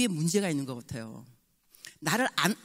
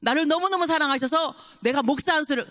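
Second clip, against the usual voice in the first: higher, excited and slow on the English; joyful son; 8 semitones higher, 4 dB louder, slower than usual. Retold or imitated. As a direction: beam with pride about ordination. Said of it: "너무 기쁜 거예요".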